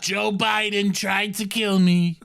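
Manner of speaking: mock rally boast, dragged out; Donald Trump. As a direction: impersonate a grandstanding president. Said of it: "Donald Trump".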